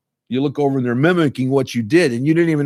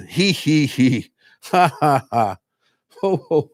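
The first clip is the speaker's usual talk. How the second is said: deliberate spaced-out fake laugh syllables; measured laughter-exercise chant; simulated laughter; slower than usual. Shown as a demonstration. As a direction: sound out a forced laugh in short bursts. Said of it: "sit there and go".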